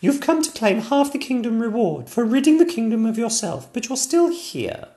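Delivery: put-on high childlike princess, apologetic; a small girl sorry to disappoint; little princess voice. Princess Sonia telling the king it won't work, high and childish.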